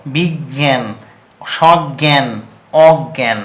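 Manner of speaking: বিজ্ঞান সজ্ঞান অজ্ঞান with a doubled g sound; pronunciation demo. Shown as a demonstration. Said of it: "তাহলে হবে দুটো গ". (দুটো গ দিয়ে উচ্চারণ)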